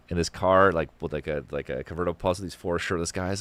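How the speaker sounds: ordinary delivery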